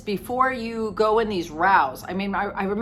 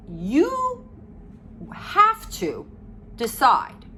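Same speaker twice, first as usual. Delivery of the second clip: you pitched much higher, drawn out; emphatic instruction; 7 semitones higher, much slower than usual. Real, not acted. Coach telling parents the first rule, emphatic. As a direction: hammer home each word. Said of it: "stresses her main instruction".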